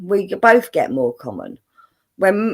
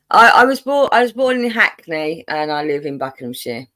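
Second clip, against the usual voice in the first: London accent, Buckinghamshire as bucknəmshi; own London accent; 4 semitones lower, 4 dB louder than usual. Real, not acted. Guest repeating her hometown in her own London accent. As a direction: let the London accent run. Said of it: "you've just said Buckinghamshire in your own accent".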